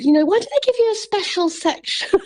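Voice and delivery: mock scandalised stranger; indignant woman. Imitating the woman resenting the wheelchair spot. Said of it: "And she said".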